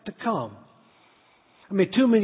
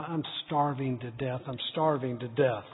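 lower, much softer grumble; boy stomping out of the kitchen, muttering lower and softer; under his breath; 7 semitones lower, 8 dB quieter than usual. Retold or imitated. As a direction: mutter it to yourself, sulking. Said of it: "under my breath".

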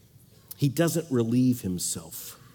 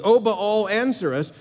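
higher, louder cry of "O Baal"; mock calling out; 6 semitones higher, 3 dB louder than usual. Preacher voicing Baal's prophets, mock loud plea. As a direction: call to the false god like his prophets.

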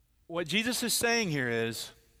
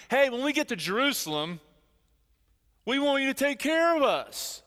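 a higher, mocking voice for the disciples; lightly hammed-up eager request, pitched up; clueless disciples; 7 semitones higher than usual. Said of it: "the disciples are so clueless".

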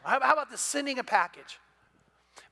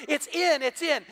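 excited; 6 semitones higher than usual. Retold or imitated. Golfer excited about sinking shot.

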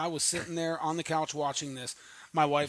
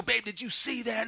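high, eager call to the kitchen; excited call; 8 semitones higher than usual. Retold or imitated. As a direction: grab the wife's attention, thrilled. Calling wife from couch.

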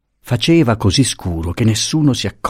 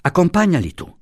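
higher urgent plea; 9 semitones higher than usual. Acted. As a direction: press him to go with them. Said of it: "gli diss'egli".

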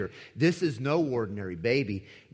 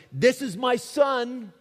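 higher, louder, slow declaration; voice of God; 10 semitones higher, 5 dB louder, slower than usual. Acted. God announcing his Son at the transfiguration, high and slow. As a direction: proclaim it slowly from above.

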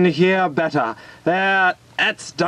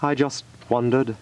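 sad; 6 semitones lower, 3 dB quieter than usual. Acted. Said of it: "he said to himself sadly".

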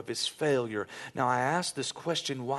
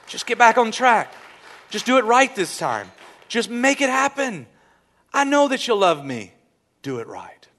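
raised, high, emphatic appeal; urgent exhortation; 8 semitones higher, 8 dB louder than usual. Retold or imitated. God to believers who keep apologizing, loud urgent urging. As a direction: press each command home loudly.

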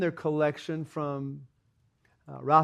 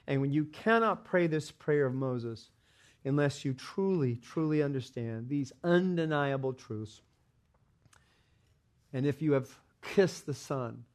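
normal talking voice

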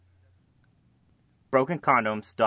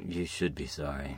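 mock stern low reply; 11 semitones lower, 11 dB quieter than usual.

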